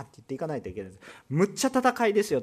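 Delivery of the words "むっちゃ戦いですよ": a burst of Kansai dialect, むっちゃ said with real force; slipping into Kansai dialect. Real, not acted. Preacher urging the congregation, in a sudden Kansai dialect. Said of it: "関西弁出てくると本音が出てきてるんですけど".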